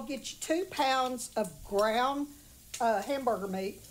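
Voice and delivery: ordinary talk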